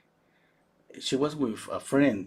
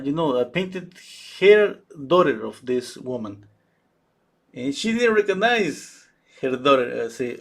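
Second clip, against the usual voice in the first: ordinary delivery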